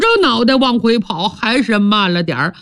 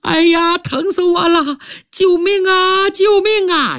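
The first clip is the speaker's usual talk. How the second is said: crying for help; 9 semitones higher than usual. A narrator's reading. Wounded man outside the door yells for help in pain. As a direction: scream for rescue, climbing higher. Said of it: "有人大叫起来".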